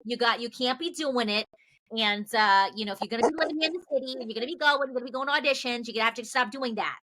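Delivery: high, hurried New York nagging; thickening New York accent. Retold or imitated. Agent warning about city auditions, thick New York accent. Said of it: "like, the thicker it got".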